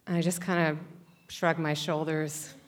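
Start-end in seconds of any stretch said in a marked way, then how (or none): none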